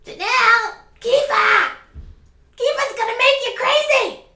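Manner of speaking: very high, loud put-on yell; acted shrieking. Scolding Janelle about Kifa, high shouted impression. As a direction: holler it far above your own voice.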